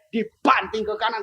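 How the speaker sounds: ordinary talk, nothing marked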